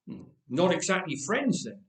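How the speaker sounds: ordinary delivery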